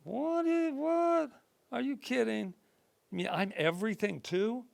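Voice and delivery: put-on high-pitched, amazed child voice; astonished child, starting very high then easing down; inner child. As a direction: squeal it like a disbelieving little kid. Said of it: "the inner child is going".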